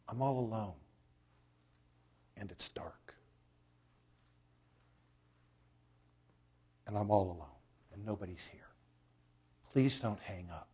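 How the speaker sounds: put-on hushed, understated plea of a lonely child; quiet, halting retold plea; voice breaking. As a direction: retell a scared caller's plea softly, with long pauses. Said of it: "the caller's voice broke".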